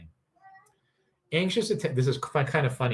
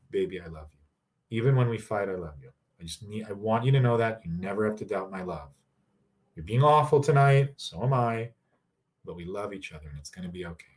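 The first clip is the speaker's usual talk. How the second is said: tender; 4 semitones lower than usual. Shown as a demonstration. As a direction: hold them close with your voice. Tender reassurance demo.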